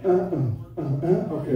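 usual speaking voice

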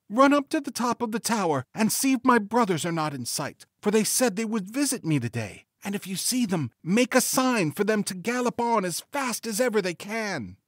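high-pitched voice of a wife urging her sister; pleading wife. A narrator's reading. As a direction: play the wife, pitched far above the narration. Wife's plea to sister.

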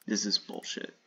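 usual speaking voice

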